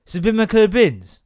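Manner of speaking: "So been my clear bins" run together loudly; garbled question. Retold or imitated. Son randomly asks about bins in the car, garbled. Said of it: "enunciating a bit better".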